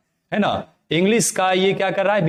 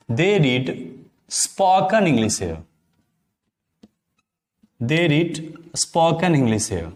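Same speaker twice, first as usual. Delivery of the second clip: one word at a time, then the whole sentence drawn out slowly; slow dictation; much slower than usual. Shown as a demonstration. Teacher gives students an example sentence, dictating slowly. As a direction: dictate it clearly for note-taking.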